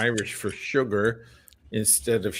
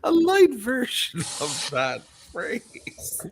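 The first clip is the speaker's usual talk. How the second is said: disbelief; 13 semitones higher than usual. Retold or imitated. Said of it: "I'm like".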